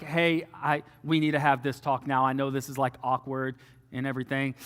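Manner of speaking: low, softened awkward approach; awkward, subdued. Retold or imitated. Man to girlfriend's mom at the door, awkward and subdued. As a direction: broach it quietly and uneasily.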